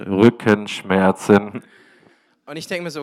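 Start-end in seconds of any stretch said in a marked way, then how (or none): 0.0-1.6 very loud, low, slow boom; booming divine voice. Retold or imitated. God turning up the volume in his head, booming loud. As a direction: thunder it out syllable by syllable. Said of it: "höre ich ganz laut".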